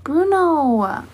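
calling the dog's name, falling; calling a pet. Real, not acted. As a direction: call him over gently. Calling the dog.

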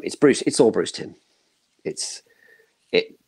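normal talking voice

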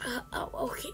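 ordinary talk

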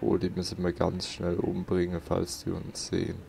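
ordinary talk

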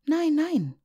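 put-on high, loud cry of a little girl. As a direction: shout it out so you're not left behind. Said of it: "rief Liese". (lauter, hoher Ausruf eines kleinen Mädchens)

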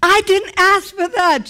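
high, loud, indignant cry; mad protest. Retold or imitated. Angry at God over unasked healing, loud and high. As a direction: throw it up at God, high and hot. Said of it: "And I was so mad".

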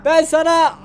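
ordinary talk, nothing marked

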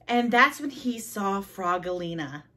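plain reading voice

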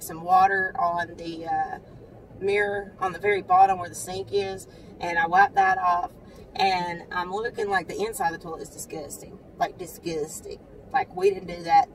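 ordinary talk

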